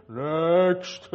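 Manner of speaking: the same mock echoing God voice, one word. Joking about judgment day, a mock booming God voice. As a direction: announce it like God at the judgment seat. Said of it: "that's the voice of God".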